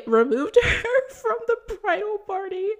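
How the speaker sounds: high laughing; laughing disbelief. Real, not acted. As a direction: laugh at how absurd it is.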